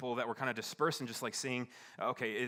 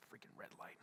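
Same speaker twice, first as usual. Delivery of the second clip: whispered mock fuming; muted anger; 17 dB quieter than usual. Driver venting at a red light, whispered mock anger. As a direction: curse it under your breath. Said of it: "Taking all my anger out".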